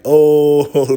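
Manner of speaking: a loud, high shocked oh; surprised oh. Retold or imitated. Son hearing a stranger claim to be his father, loud shocked oh. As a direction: blurt out a big oh in disbelief.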